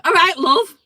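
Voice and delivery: high, very loud hail; neighbour's greeting. Acted. Acting the neighbour at the door, loud greeting. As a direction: hail her abruptly as the neighbour. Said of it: "so he comes up".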